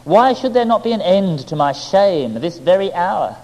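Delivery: high anguished pleading, rising to a wail; agonized cry. A narrator's reading. Sinner crying out to God under a fig tree, anguished. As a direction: beg God to end the shame. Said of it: "I cried out in my agony".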